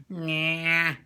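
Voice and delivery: high, nasal drawn-out catchphrase; Bugs Bunny. Shown as a demonstration. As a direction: let it come out of the nose, loud. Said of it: "this very nasal voice".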